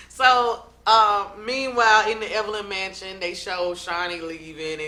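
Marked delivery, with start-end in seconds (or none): none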